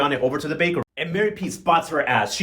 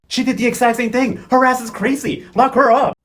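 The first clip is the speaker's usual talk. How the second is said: high, loud exclaiming; exasperated, raised-voice verdict; worked-up outrage; 6 semitones higher, 4 dB louder than usual. Real, not acted. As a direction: exclaim it higher and louder, building to the end. Worked-up outrage at cake throwing.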